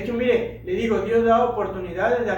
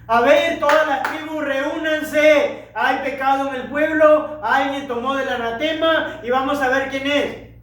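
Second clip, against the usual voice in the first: proclama en voz alta; 5 semitones higher, 4 dB louder, slower than usual. Acted. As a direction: call the tribes together, raised and high. Josué convoca a las tribus. (Josué llamando a las tribus en voz alta)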